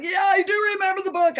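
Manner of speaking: high, fast eager reply; eager realization. Retold or imitated. Answering God's message, high fast eager reply. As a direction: blurt it out, suddenly getting it.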